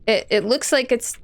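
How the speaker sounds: ordinary delivery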